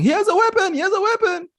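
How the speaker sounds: very high-pitched imitation of a shouting crowd; mock shouting. Co-host imitating protesters accusing a man, high mock shouting. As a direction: cry it out in a high, alarmed mock yell. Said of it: "they were just shouting".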